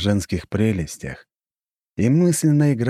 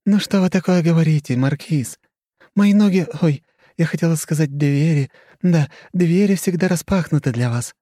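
higher, coy society lady; flirty countess; 9 semitones higher, 4 dB louder than usual. Acted. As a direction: play the flirty countess, louder. Narrator voices Khrushcheva.

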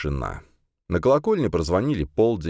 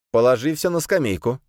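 commanding; 7 semitones higher than usual. A narrator's reading. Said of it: "скомандовал Адамберг".

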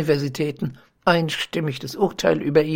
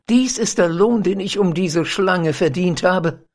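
a wronged lover crying out in bitterness; bitter cry; 3 semitones higher, 4 dB louder than usual. Acted. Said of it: "rief der Arme".

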